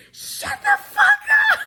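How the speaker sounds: very high, loud mock scream; hammed-up screamed command; imitated yell. Recapper voicing Ethan yelling at her, mock yell. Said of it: "He yells at her".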